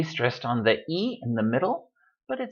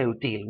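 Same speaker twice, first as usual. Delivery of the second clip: útil misstressed as u-TIL; stress on last syllable; 3 semitones lower, 5 dB quieter than usual. Shown as a demonstration. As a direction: put the weight on TIL in útil. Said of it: "but we don't say útil".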